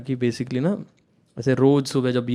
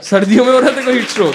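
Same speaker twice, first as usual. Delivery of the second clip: high, loud, fast sarcastic jab; mock sarcastic retort; 8 semitones higher, 7 dB louder, faster than usual. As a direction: scoff at the excuse like the teacher. Mocking sir's retort. (ऊँची, तेज़, ज़ोरदार व्यंग्य भरी फटकार)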